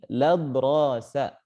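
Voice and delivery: "ladrosa" begun straight on the l, drawn out slowly; elided article. Shown as a demonstration. Teacher shows the reading that fits the rhyme, elided article. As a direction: run the article into the word, slow "ladrosa". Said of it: "maka di sini dibaca ladrosa".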